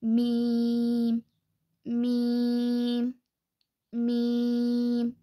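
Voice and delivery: मीम slow, from the dry part of both lips; मीम: lips closed, long ee; Arabic meem. Shown as a demonstration. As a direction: hum मीम slowly on the lips. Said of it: "दोनों होंठों के सूखे हिस्से से मीम".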